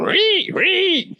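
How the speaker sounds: high, eerie screech; weird bat screech. Retold or imitated. Sailors spooking her in the dark with a shrill bat screech. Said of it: "making weird noises".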